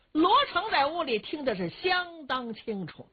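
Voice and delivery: plain reading voice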